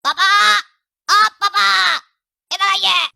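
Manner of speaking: loud call across the house; shouting for dad. Acted. Son shouting to summon father from another room, loud. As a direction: yell for dad to come running. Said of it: "यहीं से जोर से चिल्लाते पापा".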